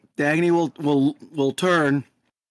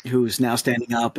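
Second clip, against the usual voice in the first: ordinary delivery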